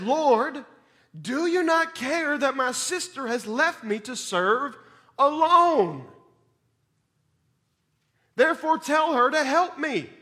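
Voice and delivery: high, loud pleading complaint; exasperated Martha. Acted. Martha complaining to Jesus about her sister, high and loud. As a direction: throw the grievance at Jesus.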